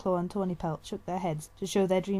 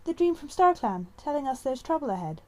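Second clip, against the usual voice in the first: uncertain; 8 semitones higher, 4 dB louder than usual. A narrator's reading. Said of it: "sounded more uncertain still".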